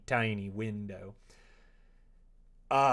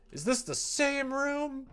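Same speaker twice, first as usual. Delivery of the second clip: much higher, slow, stressing "this" in disbelief; incredulous repeat; 5 dB louder, slower than usual. Real, not acted. Gamer asking again whether it is the same room, incredulous. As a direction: ask "this" again, not believing it.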